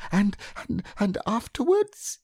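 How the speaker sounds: very high, halting, husky little voice, rising into a question; husky Piglet. A narrator's reading. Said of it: "managed to say very huskily".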